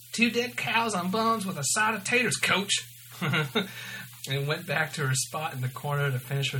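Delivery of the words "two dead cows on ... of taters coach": higher, hammed-up waitress yell; raised-voice diner order shout. A narrator's reading. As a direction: call the order through to the kitchen. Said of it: "she yelled".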